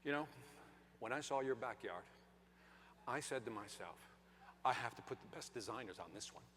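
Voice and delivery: hushed, low, intimate sales voice; salesman's pitch. Retold or imitated. Landscaper presenting to the couple, hushed reverent pitch. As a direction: confide it like flattering privileged news. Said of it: "He said".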